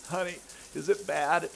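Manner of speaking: high, pitiful mock whimper; acted whimper. Hurting husband to wife, high acted whimper. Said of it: "I kinda told her through whimpers and sobs".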